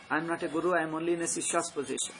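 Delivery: ordinary talk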